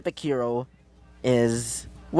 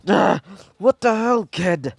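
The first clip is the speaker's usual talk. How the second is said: higher put-on villain outburst; pained sludge villain; 4 semitones higher than usual. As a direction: groan into an angry, raised villain voice. Sludge villain hurt by blast.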